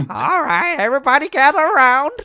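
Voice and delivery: loud, high-pitched character call to gather everyone; hammed-up high, loud voice, breaking into laughs; rally the group in a big cartoonish voice; blustery dude character. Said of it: "I'm gonna play as him".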